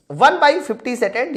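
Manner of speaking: ordinary delivery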